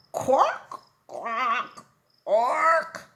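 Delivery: high, loud squawking quacks; loud bird cries; penguin quacks. Acted.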